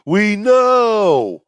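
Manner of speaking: big high yell of We know; loud heckle. Real, not acted. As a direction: shout him down, teasing. Cohost heckles loudly.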